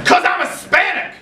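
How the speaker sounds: put-on high, defensive kid; paranoid accusation. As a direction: hurl the accusation.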